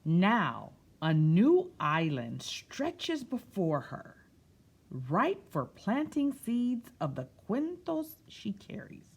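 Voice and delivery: picture-book narration; storytime reading. A narrator's reading. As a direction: read it to a room of children. Reads picture book aloud.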